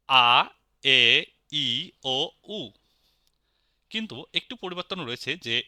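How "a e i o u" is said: pure, separated "আ, এ, ই, ও, উ", each held; German vowel sounds. Shown as a demonstration. Teacher showing learners the German vowels, drawn-out vowel sounds. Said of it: "German ভাষার উচ্চারণটা কি হবে".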